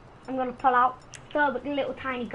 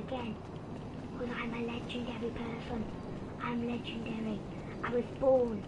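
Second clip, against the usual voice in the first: quiet; 2 semitones lower, 10 dB quieter than usual. Real, not acted. Keeping voice low after mom.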